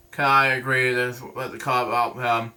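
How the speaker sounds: plain reading voice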